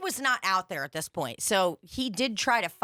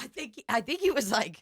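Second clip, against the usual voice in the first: normal talking voice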